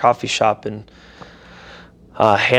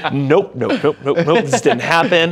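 higher, louder string of refusals; mock-panicked denial; 5 semitones higher, 4 dB louder, slower than usual. Teller refuses news that she's his wife, mock-panicked denial. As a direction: reject it fast and comically, pitch raised. Retold refusal of the divine message.

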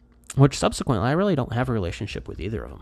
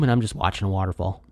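ordinary delivery

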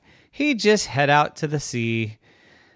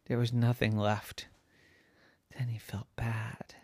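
very soft, subdued narration; hushed regret; 11 dB quieter than usual. A narrator's reading. Storyteller telling of Harry's remorse, hushed. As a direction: drop to a near whisper for the sad outcome. Narrator gives the sad aftermath.

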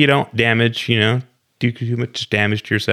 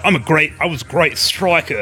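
mock Australian; 3 semitones higher than usual.

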